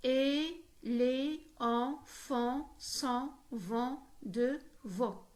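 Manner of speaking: very slow, evenly separated syllables. Shown as a demonstration. Teacher demonstrating an eight-syllable verse, syllable by syllable. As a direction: count out the verse with every syllable isolated. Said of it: "comporte huit syllabes prononcées".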